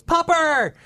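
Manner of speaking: high, loud squeal of delight; delighted popper cry. Acted. Monkey girl greeting a werewolf, delighted high shout. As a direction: call out to an adorable puppy, high and loud. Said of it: "instead of running away screaming, goes".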